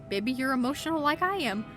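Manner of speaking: usual speaking voice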